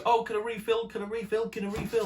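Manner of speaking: high-pitched repetitive mimicry; pestering server. Server constantly coming over to refill, in mock pestering voice.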